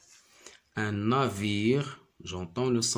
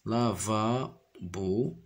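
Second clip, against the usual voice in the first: slow syllables; much slower than usual. Shown as a demonstration. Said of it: "sounding out the word".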